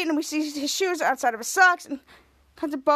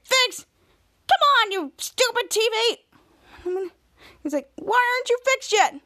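frustrated yelling; 7 semitones higher, slower than usual. Retold or imitated. Ray punching the TV.

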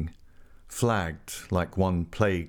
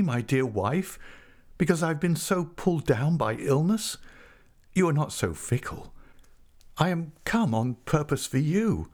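lightly voiced earnest husband, pitched up; mild, higher-pitched entreaty; pleading husband; 7 semitones higher than usual. A narrator's reading. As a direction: plead with your wife, voice raised in pitch. Angel pleading with his wife.

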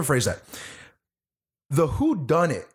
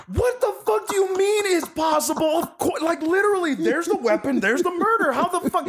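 high, stunned outburst of disbelief; mock disbelief; 14 semitones higher than usual. Viewer reacting to the professor's answer, high incredulous outburst. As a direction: squeal it out, pitched way up.

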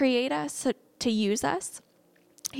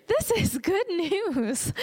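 slow, much higher, savoring "This"; delighted; 7 semitones higher, much slower than usual. Real, not acted. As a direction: draw it out with joy. Preacher delighting in verse.

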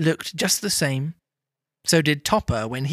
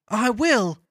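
higher-pitched eager promise; eager cry; 7 semitones higher than usual. A narrator's reading. Old gentleman agrees to visit Scrooge, eager cry. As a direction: exclaim it readily as the old gentleman. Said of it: "cried the old gentleman".

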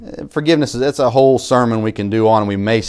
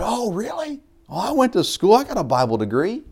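mocking, much higher voice for the swearing man; imitating the guy; 11 semitones higher, 3 dB quieter than usual. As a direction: lampoon him high and eager. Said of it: "And he said".

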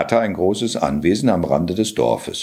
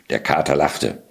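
usual speaking voice

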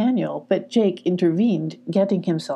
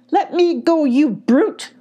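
high, loud cry of protest; hollering; 9 semitones higher, 5 dB louder, slower than usual. A narrator's reading. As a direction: holler as if struggling to get free. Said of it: "hollered Emmy".

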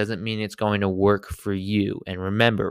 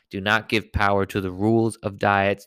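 ordinary delivery

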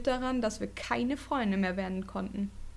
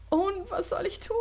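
put-on much higher, anxious girl voice; scared Heidi; 10 semitones higher than usual. A narrator's reading. Scared Heidi asks Yui what to do, higher anxious voice. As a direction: ask it like a frightened child.

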